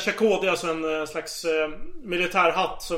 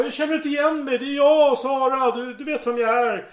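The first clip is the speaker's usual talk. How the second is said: worried; 6 semitones higher than usual. Retold or imitated. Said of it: "Sara blev orolig".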